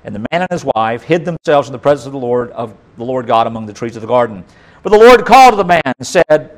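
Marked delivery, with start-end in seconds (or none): none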